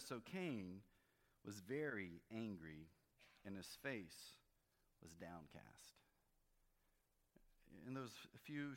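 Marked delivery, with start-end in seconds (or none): 0.0-6.0 measured Bible reading, lightly lifted; scripture reading. Acted. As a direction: read the verses out to the congregation. Reads scripture aloud.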